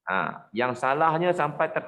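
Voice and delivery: ordinary talk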